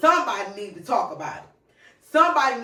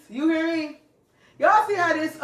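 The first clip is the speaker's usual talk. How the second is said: excited; 8 semitones higher, 5 dB louder than usual. Real, not acted. Excited hype.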